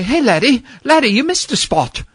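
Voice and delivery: put-on old Irish or Scottish janitor scolding the boy over a missed spot. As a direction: put on a Celtic janitor's brogue, chiding. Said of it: "a little Irish or Scottish janitor".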